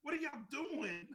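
high, incredulous yell at the refs; mock angry yelling. Dad yelling at referees during son's game, high incredulous yell. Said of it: "fucking yelling at the referees".

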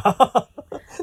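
loud burst of laughter; sudden loud laugh. Real, not acted. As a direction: laugh out loud at the scary idea. Reacting to scary idea.

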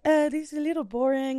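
put-on a sultry French accent. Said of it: "she's French".

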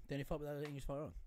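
quickly rattled off; fast tongue-twister. Shown as a demonstration. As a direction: race through the phrase without stopping. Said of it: "Say it fast".

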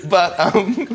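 breaks into high laughter mid-sentence; can't get the words out for laughing. Real, not acted. Laughs at own family's comments.

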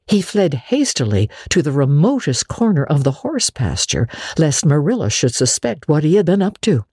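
ordinary delivery, nothing marked

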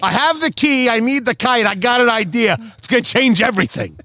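eager inventor in a put-on Boston voice; Ben Franklin. Ben Franklin telling Tommy his big idea, mock Boston accent. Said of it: "That was more Ben Franklin".

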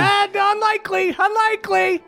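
high, hurried mother in a panic; frantic mother. Acted. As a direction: rush the words out like a mother fleeing town. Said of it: "And she goes".

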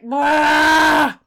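high, loud mock shriek; creepy creature wail. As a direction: howl like the Green Man. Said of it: "and he's like".

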